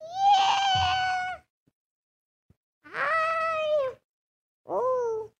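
voice changer. Pretend. Voice changer very high.

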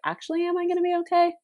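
high, anxious doubting question; mock worry. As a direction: ask it like sudden doubt grips you. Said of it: "'Cause you're like".

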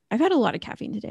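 real caffeinated rush of words; talking fast. Real, not acted. Podcast host admitting too much caffeine, talking fast. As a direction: rattle it off quickly, over-caffeinated. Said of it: "I'm sorry if I'm talking fast".

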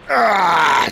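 high, loud acted scream; sudden high scream under attack. A narrator's reading. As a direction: scream out as if bitten. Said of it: "he screamed".

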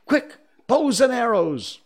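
high, loud rallying cry; excited, high-pitched command; urgent call. Acted. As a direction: shout the boys to arms. Boys rushing to arms.

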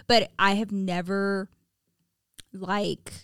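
ordinary talk, nothing marked

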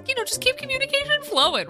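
ordinary talk

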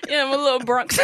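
high squealing laugh. Real, not acted. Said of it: "Is that what that was?".

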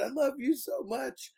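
high, teary girlfriend on the phone, hammed-up; mock crying. Girlfriend crying to Dale over the phone, mock teary and high. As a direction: imitate her weeping into the phone, half-joking. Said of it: "like, crying on the other end".

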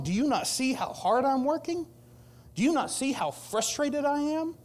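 high, exasperated complaining; frustrated Martha. Acted. Martha complains to Jesus about her idle sister, frustrated. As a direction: rant in protest, pitch swooping up high. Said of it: "She's a little frustrated with Jesus".

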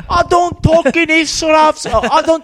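put-on loud, high, slow British accent; hammed-up British rant, pitched right up; British man impression. Re-enacting a funny video, loud British impression. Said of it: "I thought of the video".

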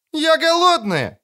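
high squeaking plea; squeaky cry. A narrator's reading. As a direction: squeak it out obediently. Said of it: "послушно запищала Цири".

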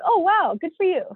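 high, exaggerated surprise; mock amazement. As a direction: imitate an impressed stranger, voice jumping up. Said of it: "He's like".

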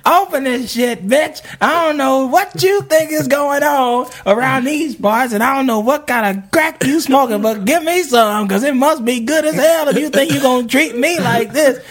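high-pitched, furious yelling up at a window; drunk furious yelling. Retold or imitated. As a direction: bellow at the girlfriend like a drunk, raging boyfriend. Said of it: "he's yelling from the bottom".

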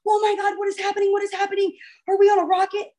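high, monotone mock panic; hammed-up high-pitched freak-out; freaking-out imitation. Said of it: "like, freaking out".

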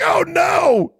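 high, loud mock yelp of horror; hammed-up alarmed shout; imitating a horrified cry. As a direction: cry out as if watching the cat fall. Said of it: "You're like".

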